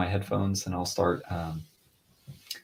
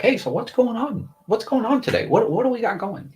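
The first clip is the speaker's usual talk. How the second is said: high-pitched mock-eager check-in; hammed-up attentive husband, pitched way up; acting involved husband; 14 semitones higher, 4 dB louder than usual. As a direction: put on a very high, keen voice. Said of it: "taking the stance to be like".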